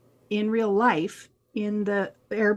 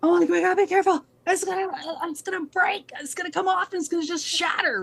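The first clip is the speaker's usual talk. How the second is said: higher, fast, panicked warning; warning friends about the sliding glass, panicked and fast; panicked alarm; 8 semitones higher, much faster than usual. Retold or imitated. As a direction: blurt it out in a panic. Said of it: "And I'm like, gasps".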